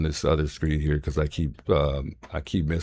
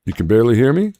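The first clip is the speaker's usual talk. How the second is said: higher, louder surprised check; surprised question; 6 semitones higher, 7 dB louder, faster than usual. Real, not acted. Host checking whether the caller can hear him, surprised and louder. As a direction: ask in disbelief, raising your voice.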